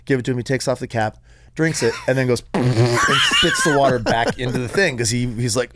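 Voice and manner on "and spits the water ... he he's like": ordinary talk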